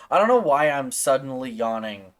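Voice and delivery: usual speaking voice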